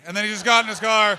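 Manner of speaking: ordinary delivery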